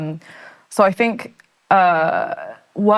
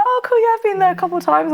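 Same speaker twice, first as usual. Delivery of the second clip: imitating a casual, chirpy girl, pitched much higher; breezy girl; 14 semitones higher, faster than usual. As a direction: toss it off lightly, way up in pitch. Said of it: "And she was like".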